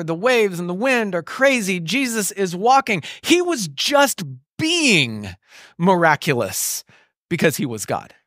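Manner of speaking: ordinary talk, nothing marked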